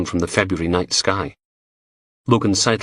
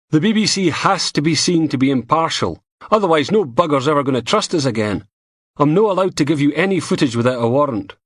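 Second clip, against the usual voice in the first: higher, apologetic Scottish bloke; BBC technician; 7 semitones higher than usual. A narrator's reading. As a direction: explain the rules, regretful.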